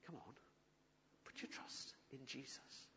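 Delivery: unvoiced, very soft; a whispered nudge from the Spirit; whispered inner voice. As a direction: breathe it like a quiet voice inside. Voicing the little inner voice.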